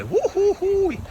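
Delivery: high whooping woo-hoo-hoo; real delighted whooping; excited woo-hoo. Real, not acted. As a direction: whoop it up in joy. Celebrating the sweetie tree.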